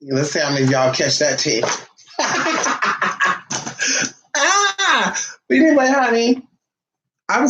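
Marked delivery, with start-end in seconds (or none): 2.2-4.1 long, breath-only cackling laugh; cackle. Real, not acted. Laughs at own joke. 4.4-5.2 high-pitched squeal capping a laugh. Real, not acted. Cry capping her own laugh.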